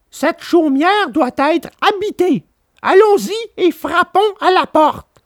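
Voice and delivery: put-on high, gentle boy puppet; Pinocchio voice. A narrator's reading. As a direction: coax his weak father along kindly. Pinocchio decides.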